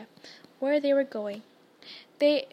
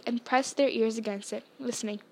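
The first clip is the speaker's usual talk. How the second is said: ordinary delivery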